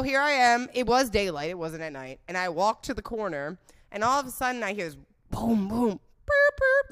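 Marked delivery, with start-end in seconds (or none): 5.3-6.9 high, loud honking noises; imitated car honk. She hears someone honk at the corner, imitated horn. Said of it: "like someone honks".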